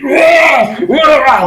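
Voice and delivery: loud high pained ogre wail; monster wail. Acted. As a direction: howl out like a burning ogre. Ogres burst into flames.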